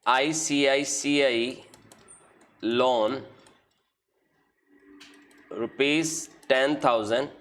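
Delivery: slow, drawn-out words spoken as they are written on the board; slow dictation while writing. Shown as a demonstration. Teacher writing entries on the board, slow dictation. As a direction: dictate each word slowly as you write it.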